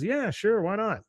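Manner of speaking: mock casual reply. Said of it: "He goes".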